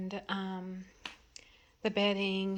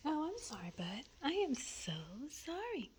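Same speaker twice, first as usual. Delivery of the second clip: sorry to the dog; 9 semitones higher than usual. Real, not acted. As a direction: coo an apology to the dog. Apologizing to her dog.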